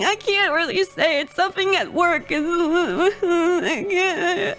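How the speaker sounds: imitated tearful explaining; mock weepy, words trailing into a wail; retold crying. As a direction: act out dissolving into sobs mid-sentence. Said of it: "I broke out in tears".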